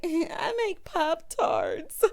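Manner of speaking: high, slow, sheepish mimicry; imitated grinning bride. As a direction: stretch it out high through a big grin. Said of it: "started skinning and grinning like".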